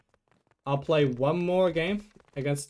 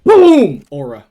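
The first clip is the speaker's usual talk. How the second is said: hyped gamer bro; 18 dB louder, much slower than usual. Real, not acted. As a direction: shout it out after a hit. Scores a hit in game.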